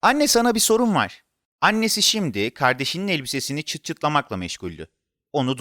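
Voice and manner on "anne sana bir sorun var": put-on high, eager child voice; insistent child. A narrator's reading. Boy trying to ask his mother a question, insistent. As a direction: pipe up to get mum's attention.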